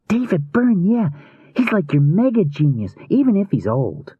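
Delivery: put-on high, youthful boy voice, gushing; enthusiastic boy. A narrator's reading. Adam raves to Rune about the band, enthusiastic boy. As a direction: play a music-mad kid, pitched high.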